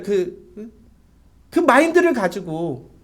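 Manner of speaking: normal talking voice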